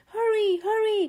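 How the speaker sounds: loud, high urgent call; urgent fire chief shout; calling out urgently. A narrator's reading. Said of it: "called the fire chief".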